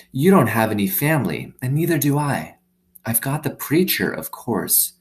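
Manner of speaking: put-on a girl confiding in her new dog; young girl Opal.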